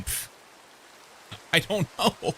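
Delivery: shrugging mock voice; pff, I don't know, dismissive; soft pff. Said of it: "Are you saying".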